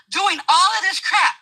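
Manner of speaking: ordinary delivery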